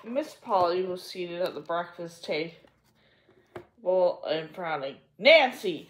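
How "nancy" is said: loud, stern call of a name. A narrator's reading. Aunt Polly summoning Nancy, loud and stern. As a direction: snap the maid's name. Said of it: "she said sternly".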